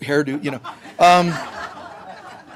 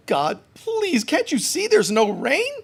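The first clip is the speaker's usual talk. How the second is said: higher whiny pleading; victim prayer; 6 semitones higher than usual. Pretend. Imagined victim prayer for rain, mock whiny plea. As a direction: whine at God like a helpless victim. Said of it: "You don't pray as a victim".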